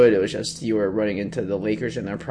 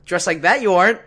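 high, louder doubting retort; sceptical jab; 6 semitones higher, 6 dB louder than usual. Retold or imitated. Desk guy doubts the well-dressed player, sceptically. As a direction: call his bluff, mockingly.

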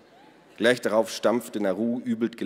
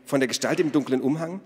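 higher-pitched, eager follow-up; excited question; 6 semitones higher, 4 dB louder than usual. A narrator's reading. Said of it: "fragte Ada aufgeregt". (aufgeregt nachgefragt)

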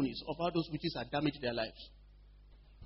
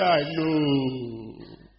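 long drawn-out falling moan; mock-demon groan, stretched out; mock demon wail; 3 semitones lower, 4 dB louder than usual. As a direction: imitate a defeated demon admitting it. Imitates demon's reply to being reminded.

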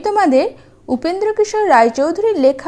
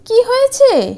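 worried wife; 10 semitones higher than usual. Acted. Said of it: "উনা বলল".

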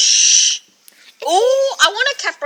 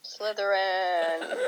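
slow, softer ominous verdict; Sorting Hat call; 5 semitones lower, 12 dB quieter, much slower than usual. Pretend. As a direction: drop the name darkly and slowly. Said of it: "can you do the Sorting Hat?".

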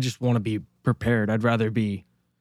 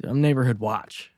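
ordinary talk

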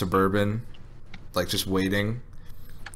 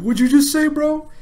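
much higher, louder drunk guy imitation; mock drunk challenge; 6 dB louder than usual. Retelling the drunk guy squaring up to his brother, high mock challenge. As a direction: bark it like a drunk picking a fight. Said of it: "the guy, like, looks around, like".

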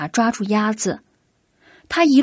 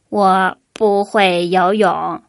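duck's voice; 3 semitones lower, slower than usual. A narrator's reading. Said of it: "鸭子说".

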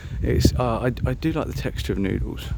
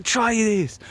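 higher-pitched imitation of a pushy order; mock aggressive insistence; 7 semitones higher than usual. Imitating wife pushing food on him, mock aggressive. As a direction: press it on them in a put-on voice. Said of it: "she's very aggressive".

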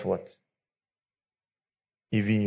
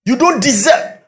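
loud, higher, slow furious rebuke; angry shout; 11 semitones higher, 9 dB louder than usual. Retold or imitated. Friend yelling at the foolish husband, furious. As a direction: yell it like a scolding friend. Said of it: "He said".

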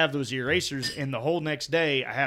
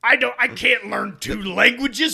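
mock outrage; 8 semitones higher, 6 dB louder than usual. Joker refusing foreign languages, loud mock outrage. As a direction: play the offended monolingual American. Mock ignorant American defending himself.

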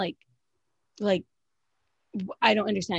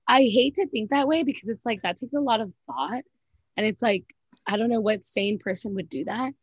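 usual speaking voice

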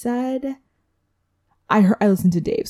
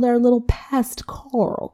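conspiratorial whisper; 5 semitones higher, slower than usual. Acted. Introducing the sneaky contestant.

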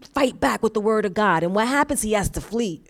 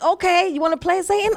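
high-pitched playful taunt; cocky challenge; 7 semitones higher than usual. Acted. Preacher taking on Satan, cocky and high-pitched. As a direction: take on an opponent with mock bravado.

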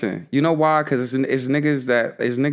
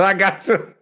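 higher, louder mock of his friend; mimicking his friend; 9 semitones higher, 4 dB louder than usual. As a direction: parrot the line back in a higher voice. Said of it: "He said".

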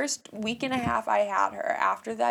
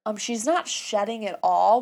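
normal talking voice

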